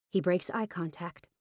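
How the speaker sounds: usual speaking voice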